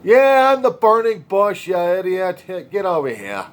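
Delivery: high, loud New York wise-guy God, easing down at the end; a brash New York accent, telling him off; New York accent, annoyed and mocking. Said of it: "Started talking to him in a New York accent".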